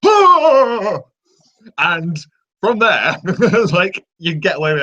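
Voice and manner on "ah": very high, loud, sharp yell; wizard's attack shout; Gandalf duel cry. Retold or imitated. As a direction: strike with the staff and let out a short battle cry. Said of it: "that is the wizard duel noise".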